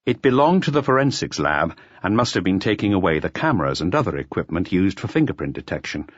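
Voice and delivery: ordinary delivery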